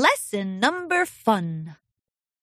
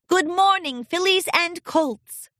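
high, drawn-out bright greeting; cheerful chirp; 8 semitones higher than usual. A narrator's reading. Princess greeting a silent class of ponies, chirpy. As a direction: chirp it out to stir a reaction. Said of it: "Celestia chirped".

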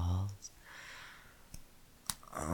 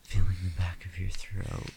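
ordinary delivery

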